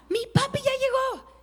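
put-on high, bright child's shout of joy; excited little boy. Pastor retelling how her little son cried out, high childlike voice. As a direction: squeal it like a happy four-year-old.

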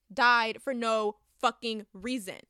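loud, slow, furious emphasis; angry emphasis. Real, not acted. Reviewer venting about a pointless death, angry and slow. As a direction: hammer each word with anger.

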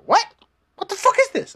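high, loud incredulous yelp; mock disbelief. Vlogger reacting to the strange cutscene, high incredulous yelp. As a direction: play up your own baffled reaction. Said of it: "I'm like".

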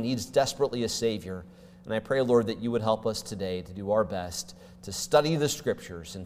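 hushed pastor's prayer. Acted.

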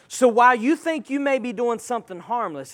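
usual speaking voice

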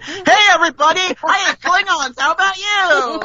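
hammed-up, oblivious greeting to the room; Barry Waddle. Barry bursts in on party guests, boisterous mock greeting.

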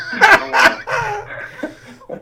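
words squeezed out through laughter; laughing. Real, not acted. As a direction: laugh through it, slow and breaking up. Laughing at own story.

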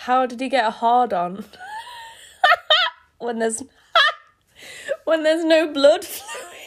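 cracking up mid-line; words breaking into laughter; real laughter spilling out; laughing. Real, not acted. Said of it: "I'll read that without laughing".

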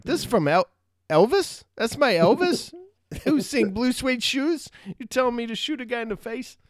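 can't believe Elvis said that; mock disbelief. Viewer reacting to Elvis's order, mock-incredulous.

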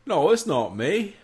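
high, slow, shaky attempt at English; badly faked English accent, drawn out. Host mimics intro scene, badly faked English accent. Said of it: "I can't do an English accent".

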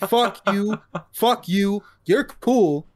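mock angry outburst.

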